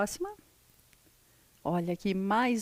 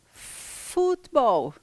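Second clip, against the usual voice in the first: futebol stretched out slowly and higher; slow model; 4 semitones higher, 3 dB louder, much slower than usual. Shown as a demonstration. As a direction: draw out futebol slowly for learners. (futebol bem devagar e alongado)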